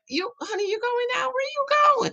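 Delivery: high, sing-song husband asking where she's going; husband's voice. Retold or imitated. Husband quizzing his dressed-up wife, high and sing-song. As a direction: squeak it out like a suspicious husband. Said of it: "He said".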